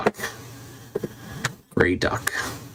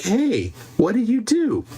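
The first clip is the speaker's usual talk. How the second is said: lightly acted, higher-pitched caller's question; voiced caller; 10 semitones higher, slower than usual. Golfing guy calls her up tomorrow, lightly voiced question. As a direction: put on a high, inquiring voice. Acts out golfing guy's call.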